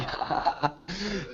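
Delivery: amused, high-pitched laugh; real laugh. Real, not acted. Teacher reacting to student's example about mother, laughing. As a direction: laugh softly in genuine amusement.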